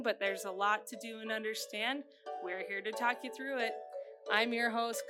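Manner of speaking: ordinary talk, nothing marked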